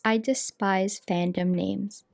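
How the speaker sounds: flat text-to-speech reader; robot voice. Acted. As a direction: read it like a computer voice. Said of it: "I'll have the robot voice read the post".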